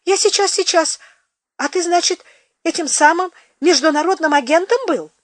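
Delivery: high, anxious fluster; fumbling for clothes in fear. Acted. Flustered frightened peasant.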